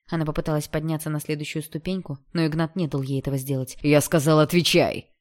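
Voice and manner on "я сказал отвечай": loud, angry command; almost growled. A narrator's reading. Stepbrother blocks her on the stairs, nearly growling. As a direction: growl the order forcefully. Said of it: "почти прорычал он".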